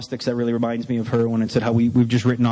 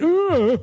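high, sustained "ugh"; mock whimper of dread; 13 semitones higher than usual. Asked to write it all down, high dreading whimper. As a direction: whine it high, like a cornered man.

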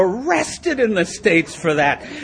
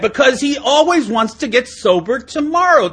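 usual speaking voice

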